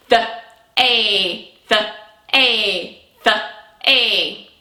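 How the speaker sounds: "The" and "a" said as separate stretched sounds. Shown as a demonstration. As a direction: pull the word apart into "The", "a". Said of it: "stretch out our sound".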